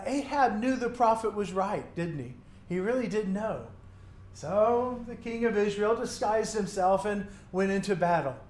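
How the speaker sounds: ordinary talk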